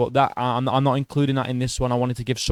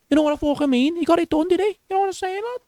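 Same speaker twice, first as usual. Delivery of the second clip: squeaky mock Scouse; faster than usual. Mocking Paddy's Scouse catchphrase.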